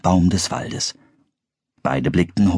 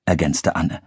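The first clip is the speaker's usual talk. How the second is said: usual speaking voice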